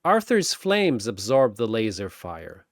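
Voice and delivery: ordinary delivery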